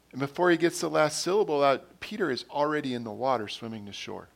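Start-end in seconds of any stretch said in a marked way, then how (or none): none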